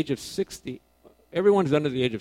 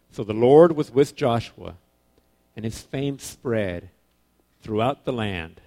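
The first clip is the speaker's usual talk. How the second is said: slow, much louder start, weight on Lord; solemn scripture reading; 7 dB louder, slower than usual. Acted. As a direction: read the verse like a proclamation.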